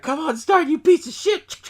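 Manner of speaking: high, loud pleading curse; mock frustrated yell. House mom curses her stalled car, mock frustrated yell. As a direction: strain upward as if willing an engine to turn.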